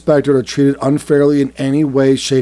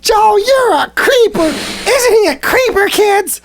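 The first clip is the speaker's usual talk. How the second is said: put-on much higher, squealing mascot voice to kids; creepy kids' mascot; slower than usual. Mascot telling kids Joe is a creeper, squealing high voice. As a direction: squeal at the kids like a cartoon host.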